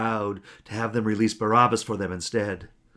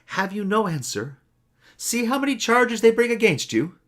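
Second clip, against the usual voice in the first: much higher, rising to louder disbelief; a governor pushing a silent prisoner; Pilate pressing; 12 semitones higher, 5 dB louder than usual. A narrator's reading. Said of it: "And Pilate asked him again".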